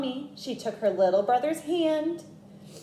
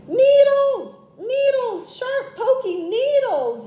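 high, loud yelp about needles; yelled; 13 semitones higher, 5 dB louder, slower than usual. A narrator's reading. Little brother yells about the porcupine needles. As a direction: cry out high, pricked. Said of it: "yelled Tate".

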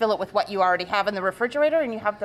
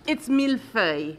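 millefeuille said the French way; French accent. Shown as a demonstration. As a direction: say "millefeuille" as a native would. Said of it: "can you say it in the real French accent".

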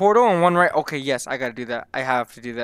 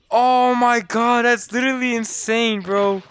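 high, drawn-out "oh my god"; awed excitement; 5 semitones higher, slower than usual. Real, not acted. Player still stunned by the masks, awed excited exclaiming. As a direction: marvel at it in disbelief.

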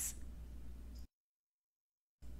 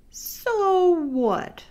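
defiant retort; 12 semitones higher than usual. Acted.

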